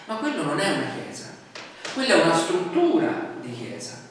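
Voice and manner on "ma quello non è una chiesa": ordinary talk, nothing marked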